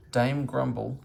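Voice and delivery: plain reading voice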